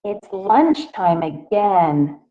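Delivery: plain reading voice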